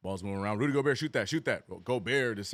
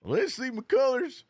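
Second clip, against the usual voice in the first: higher, slow Southern drawl on "McCullers" with a rising end; Southern accent; 10 semitones higher, 3 dB louder, slower than usual. Pretend. Streamer deliberately repeats his Southern misreading for chat. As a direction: lean into a hammed-up Southern read. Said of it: "That's exactly how I wanted to read it".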